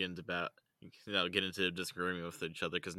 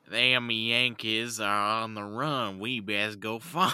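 loud, slow Southern drawl; hammed-up Yankee-hating rebel; Southern accent; 3 semitones higher, 8 dB louder, much slower than usual. Retelling the American Revolution in a Southern accent. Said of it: "story of the American Revolution".

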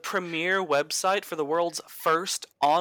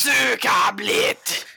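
slow, stretched, louder mock Russian shout; mock Russian gamer; 6 semitones higher, 5 dB louder, much slower than usual. Imitating Russian CS:GO players, a slow mock Russian yell. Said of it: "That's not how you say it!".

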